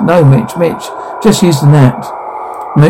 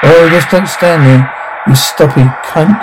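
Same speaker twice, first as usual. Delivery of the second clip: ordinary delivery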